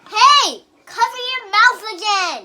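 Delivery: yelling at dad to cover up; loud scolding. Real, not acted. Child scolds after sneeze.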